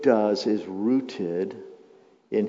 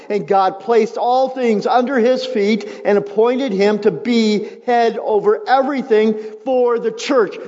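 louder, higher questions to the crowd; full-force preaching; 10 semitones higher, 7 dB louder than usual. Acted. Pastor voicing the congregation's doubt about trusting God, preaching at full force. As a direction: press the doubt hard.

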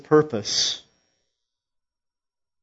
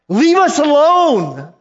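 high, loud shrieking plea of demons; demons' loud protest; 14 semitones higher, 9 dB louder than usual. Acted. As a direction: cry out like cornered spirits.

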